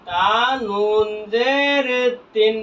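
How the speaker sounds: very slow, stretched syllables; slow spelling drill, vowels held long; drawn-out spelling. Shown as a demonstration. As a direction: draw out each letter name for learners. Teacher spells syllable aloud for learners.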